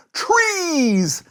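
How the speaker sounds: "Trees!" cried out high to the sky; a high, loud cry of "Trees!". A narrator's reading. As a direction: call "Trees!" upward, high and strong. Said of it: "looked high into the sky and cried".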